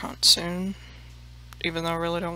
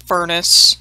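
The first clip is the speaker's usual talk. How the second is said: louder punchline word; loud emphatic word; 10 dB louder than usual. Real, not acted. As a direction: belt out the single word.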